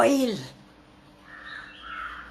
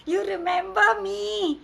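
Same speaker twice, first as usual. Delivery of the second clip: put-on friendly boy; 7 semitones higher than usual. A narrator's reading. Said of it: "he said".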